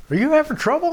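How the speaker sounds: high loud teasing question; a guard asking, high and loud; imitating the guy at the gate; mock guard voice. Gate guy asks the driver.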